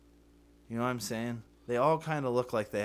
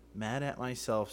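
normal talking voice

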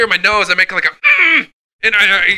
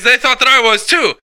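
ordinary talk

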